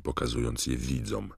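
ordinary delivery

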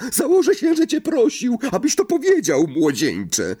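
loud, very high-pitched scoffing; boisterous, high mocking accusation; jeering roar. A narrator's reading. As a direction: crow it as if catching him out. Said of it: "ryknęła głowa Sir Patryka".

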